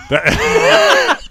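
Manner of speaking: high, loud shrieking laugh that fades to breath; real laughing shout trailing into airy laughter; burst of laughter. Real, not acted. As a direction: crack up hard. Cracking up at own joke.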